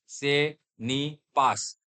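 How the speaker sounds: से नी पास spelled out slowly, the s sounded, as a mistake; wrong reading. Shown as a demonstration. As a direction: read से नी पास slowly, as a learner would. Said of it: "ऐसा पढ़ोगे, लेकिन no".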